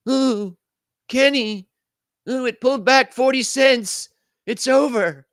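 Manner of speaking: high, whiny mock panic; mock panicky viewer. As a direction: parody a nervous shareholder crying wolf.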